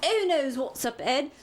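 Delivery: high Cockney warning to a friend; Cockney, high and cautionary; Cockney accent. Acted. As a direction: keep your mate alert, Cockney style. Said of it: "what kind of accent I'm gonna do".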